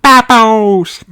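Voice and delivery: put-on very high, loud toddler cry; excited little George. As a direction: squeal it like a thrilled toddler. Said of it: "cries George".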